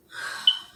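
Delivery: heavy, audible exhale; exasperated sigh. Real, not acted. Friend forgets their talks again, exasperated sigh. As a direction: sigh it out, giving up.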